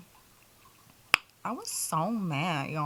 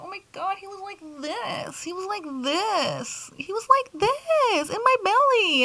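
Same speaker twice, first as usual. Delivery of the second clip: high-pitched amazed gush, building louder; amazed high quote; 11 semitones higher, 3 dB louder than usual. Retold or imitated. To her viewers, retelling her amazement at her son, high amazed gush. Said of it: "I was like".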